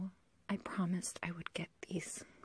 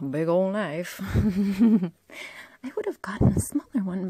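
manic laugh; 12 dB louder than usual. Acted. Laughs about her big knife.

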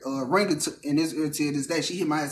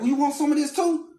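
mock tough guy; 9 semitones higher than usual.

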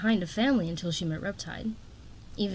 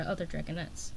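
plain reading voice

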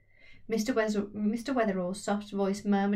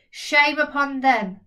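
loud, slow, higher declamation; headline proclaimed; 5 semitones higher, 9 dB louder, slower than usual. Acted. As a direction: boom it like a bold print headline. Reading a bold headline.

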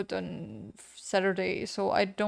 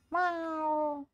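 cat meow; 6 semitones higher, 4 dB quieter than usual. Pretend. Meowing at cat in game.

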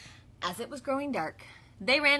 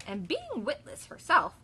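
ordinary delivery, nothing marked

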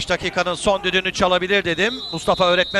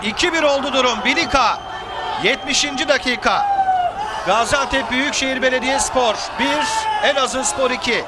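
Turkish football commentator; 3 semitones higher than usual. Acted.

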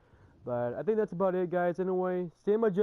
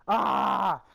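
sudden yell; 4 semitones higher, 8 dB louder than usual. Real, not acted. Sudden yell during game.

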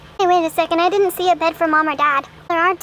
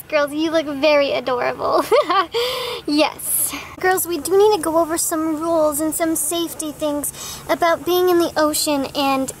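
ordinary talk, nothing marked

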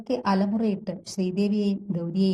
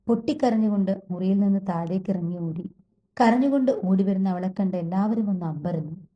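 ordinary delivery, nothing marked

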